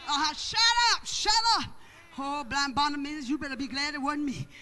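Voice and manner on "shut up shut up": high, loud hushing shout; sharp, raised-voice rebuke, said twice; scolding church folks. Acted. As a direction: shout them down as the indignant congregation. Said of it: "the church folks, you know how y'all is".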